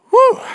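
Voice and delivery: loud, high-pitched whew; winded whew. Real, not acted. Preacher out of breath just reading it, winded whew. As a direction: blow out a big "whew" after a long read. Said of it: "I am out of breath".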